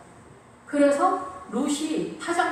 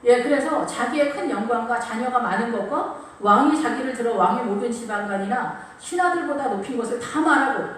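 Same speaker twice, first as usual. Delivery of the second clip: ordinary talk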